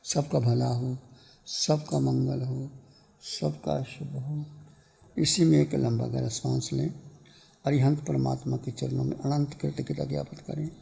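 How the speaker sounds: hushed, low closing of a meditation; soft meditation blessing. Real, not acted. Teacher blessing all and easing meditators out, soft guided meditation. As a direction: bring them out gently, low and soft.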